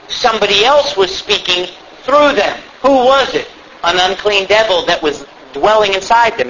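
ordinary delivery

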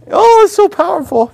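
high mock gushing moviegoer; moved moviegoer. Moviegoer leaving Old Yeller, mock gushing. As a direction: gush over the movie in a high voice. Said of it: "walk out of Old Yeller".

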